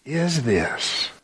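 mildly amazed rising question. Acted. Astonished crowd asking each other, amazed. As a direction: wonder at what you just saw. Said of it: "so amazed they asked each other".

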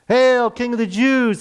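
loud, slow jeering salute; mocking hail. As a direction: drag it out like soldiers sneering at a beaten prisoner. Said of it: "they mocked".

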